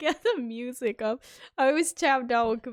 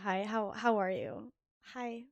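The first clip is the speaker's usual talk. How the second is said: usual speaking voice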